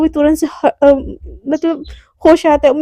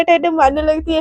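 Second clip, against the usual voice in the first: usual speaking voice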